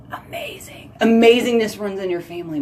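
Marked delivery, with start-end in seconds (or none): 0.1-0.9 whispered echo, barely voiced. Real, not acted. Echoing her own praise to a listener in a whisper. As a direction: breathe the word out in awe. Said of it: "That makes her even more amazing".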